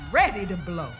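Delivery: ordinary delivery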